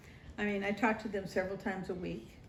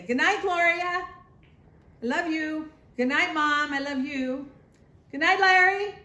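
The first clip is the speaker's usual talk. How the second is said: loud high sing-song calls through the house; calling good night; 8 semitones higher, 9 dB louder than usual. Retold or imitated. Family yelling good nights through the house, loud and high. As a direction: call out each name like yelling room to room. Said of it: "My mom would usually start and say".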